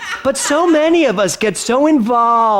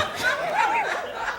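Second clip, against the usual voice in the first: sudden high-pitched giggle, softer than her talk; high burst of laughter; 10 dB quieter than usual. Real, not acted. Amused speaker to audience, a high quick laugh. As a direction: break into a high laugh at the answer. Audience member's funny answer.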